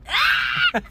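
loud high shriek of mock offense; outraged shriek. Real, not acted. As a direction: shriek as if insulted. Mock outrage at friend's tease.

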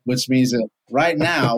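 usual speaking voice

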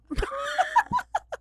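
a sudden high shriek of laughter, half horrified; shocked laughing shout. Real, not acted.

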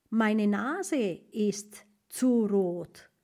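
very slow, clear German; teacher modelling a German sentence; slow model phrase. Shown as a demonstration. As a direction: read it word by word. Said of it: "slow German model phrase".

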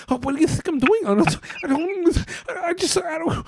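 high, stammering neurotic whine; Woody Allen impression. Host slides into Woody Allen, high and flustered. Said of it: "I wanna go into Woody Allen".

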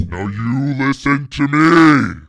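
angry roar. Acted. Ogre shouting down the host who ordered him out, slow angry roar.

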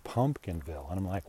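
ordinary talk, nothing marked

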